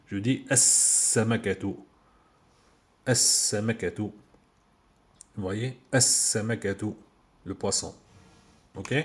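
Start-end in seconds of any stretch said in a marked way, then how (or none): 0.5-4.0 "As-Samakatu" with a long doubled s and no L; as-samakatu, hissing s held; L assimilated. Shown as a demonstration. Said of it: "Je prononce pas L". 5.9-6.9 "As-Samakatu" with a long doubled s and no L; as-samakatu, hissing s held; L assimilated. Shown as a demonstration. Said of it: "Je prononce pas L".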